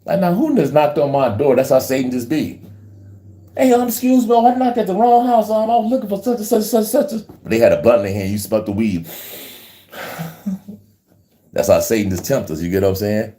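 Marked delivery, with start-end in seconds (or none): 3.6-7.3 high imitation of the smoker. Visitor at the door, retold.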